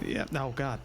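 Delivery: usual speaking voice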